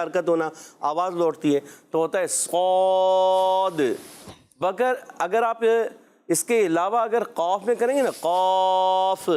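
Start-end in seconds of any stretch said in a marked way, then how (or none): 1.9-3.8 صاد said slowly with the sound bouncing back at the end; slow صاد with qalqala rebound; echoing qalqala. Shown as a demonstration. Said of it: "یعنی آواز لوٹ کر". 8.1-9.3 قاف held long with a false bounce at the end; demonstrating a wrong echoing قاف; wrong qalqala. Shown as a demonstration. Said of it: "یہ غلط ہو جائے گا".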